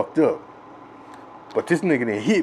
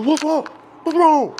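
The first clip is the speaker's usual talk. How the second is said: high, urgent asking what happened; concerned big brother; 3 dB louder than usual. Retold or imitated. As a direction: voice the brother checking on the kid. Brother asks crying kid.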